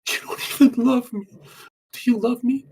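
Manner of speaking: put-on high, loud whiny girl voice; hammed-up clingy girlfriend pleading; obsessed girl. Obsessed girl interrupting intimacy, high whiny mock pleading. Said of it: "they'll be like this".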